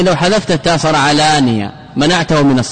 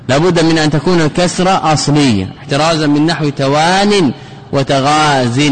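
normal talking voice

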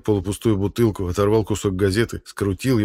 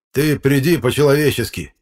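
tag; 7 semitones higher, 6 dB louder than usual. Acted. Electrician quarrels with chairman.

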